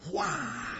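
ordinary delivery

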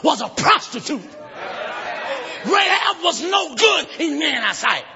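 forceful shouted sermon with rhythmic pauses; full-force preaching. Acted. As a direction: declaim the story to the congregation.

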